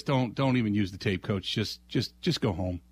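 lightly voiced, sending him away; a hint of a fed-up store manager; weary manager. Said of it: "manager just says".

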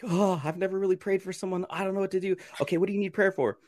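high, anxious rush of words; nervous high voice. Retold or imitated. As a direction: squeak it out like a nervous beginner. Nervous first prayer.

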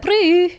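high, loud lip-buzz squeak; squeaky shoe noise. Retold or imitated. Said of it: "My shoes went".

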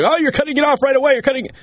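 high, fast whiny objection; mock viewer complaint. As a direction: parody an impatient critic cutting in. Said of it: "So for anybody out there".

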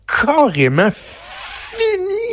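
drawn-out high dramatic tone; mock dramatic. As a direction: stretch it out like a melodrama. Mocking her dramatic claim. (ton dramatique moqueur)

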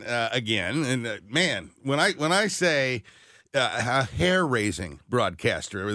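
ordinary talk

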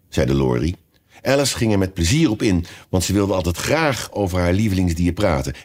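usual speaking voice

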